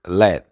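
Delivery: "Let" cut off on an unreleased T; silent final T. Shown as a demonstration. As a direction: shape the T in "Let" but don't sound it. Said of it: "pero no la pronuncia". ("Let" sin pronunciar la T final)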